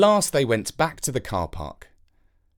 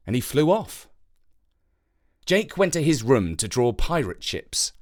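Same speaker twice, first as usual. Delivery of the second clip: usual speaking voice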